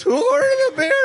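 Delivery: squeaky, sky-high mocking imitation; voice up three octaves. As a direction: mimic a voice jumping absurdly high. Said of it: "Your voice just went up three octaves".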